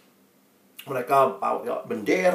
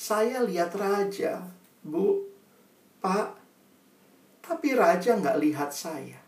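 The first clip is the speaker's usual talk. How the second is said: slow, much softer repeat with pauses; subdued sorrow; 4 semitones higher, 6 dB quieter, slower than usual. Acted. Pupil tells Bu and Pak her hurt, subdued sorrow. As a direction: let the hurt land quietly. Child's line repeated softly for effect.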